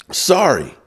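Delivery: high-pitched, dismissive mock apology; hammed-up official refusal; mock NCAA official. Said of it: "who the N C double A has said".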